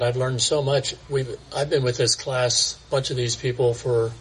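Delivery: ordinary talk, nothing marked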